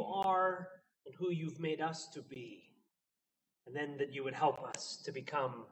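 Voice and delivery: soft, reverent prayer voice. Acted. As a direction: pray it gently and humbly. Opening prayer.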